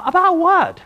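loud, high-pitched retold question; taken aback. Preacher replaying his answer to the men who want to meet, taken aback. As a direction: throw it back in loud surprise. Repeats his startled reply in retelling.

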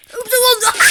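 sudden high panicked yell; panicked shout at the game. Real, not acted. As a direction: shriek it in a panic. Panicked during night shift game.

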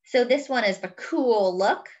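usual speaking voice

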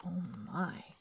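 plain reading voice

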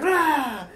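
loud startled ah; scared scream. Retold or imitated. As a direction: yelp like a jump scare. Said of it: "I was like".

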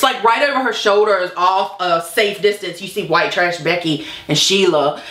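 normal talking voice

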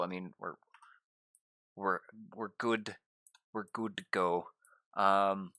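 ordinary talk, nothing marked

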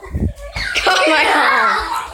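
laughing yelp of pain; hit my funny bone. Real, not acted. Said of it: "I just hit my funny bone".

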